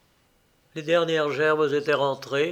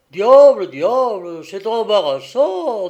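loud, high, drawn-out exclamation; taken aback; 5 semitones higher, 10 dB louder, slower than usual. A narrator's reading. Father weighs his son's surprise choice, taken aback. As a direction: ponder aloud in loud dismay.

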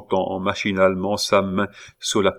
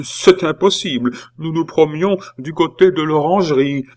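higher, louder protest; indignant denial; 8 semitones higher, 5 dB louder than usual. A narrator's reading. Nephew denying his aunt's accusation, indignant. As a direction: deny it hotly, pitch held up.

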